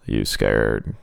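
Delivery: very low, soft clown; sinister low question; creepy clown. Acted. The clown asks Sadie if she is scared, very low.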